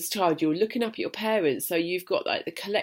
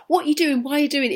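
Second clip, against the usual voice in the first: put-on higher-pitched nagging older sister; imitated nagging; 7 semitones higher, much faster than usual. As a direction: mimic a sister badgering with questions.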